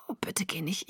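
breathy whispered plea, no voice. Acted. Exhausted girl whispers to Jacob, pleading whisper. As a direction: beg him softly in a whisper.

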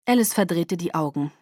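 usual speaking voice

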